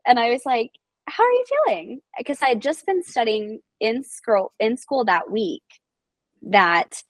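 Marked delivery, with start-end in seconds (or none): 1.1-2.0 high, sugary nurse voice; a student nurse asks an amputee gently how she feels; sweet check-in. Retold or imitated. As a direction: ask it with careful over-sweetness. Nurse asking gently, retold.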